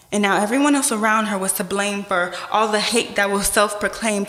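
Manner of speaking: ordinary delivery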